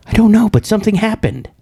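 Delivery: high mock-frantic answer; imitating alarmed caller. Caller unsure what happened, high mock alarm. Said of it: "she was like".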